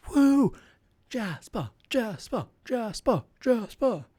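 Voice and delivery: high-pitched whoop, then a chanted name; crowd cheer. Shown as a demonstration. Performer showing the audience how to cheer, high crowd chant.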